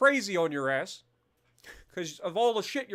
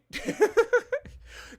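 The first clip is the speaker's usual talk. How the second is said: real high-pitched laugh at his own joke. Real, not acted. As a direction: break into a high burst of laughter.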